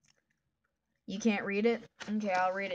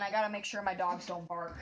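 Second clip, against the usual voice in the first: ordinary talk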